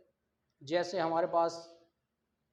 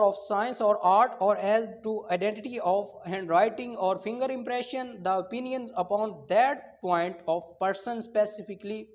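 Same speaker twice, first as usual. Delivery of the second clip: slow statute reading; 6 dB louder, much slower than usual. Acted. Teacher to law students, loud slow dictation of the statute. Reads statute text aloud.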